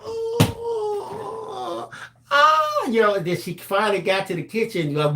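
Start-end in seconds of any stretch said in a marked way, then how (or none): 0.0-2.9 high startled oh, oh; panicked gasp. Retold or imitated. Son caught out, gasping in panic at his mother. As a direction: gasp in fright.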